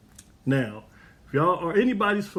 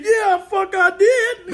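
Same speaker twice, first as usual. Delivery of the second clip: laughing boast; 8 dB louder, much slower than usual. Retold or imitated. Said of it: "He said".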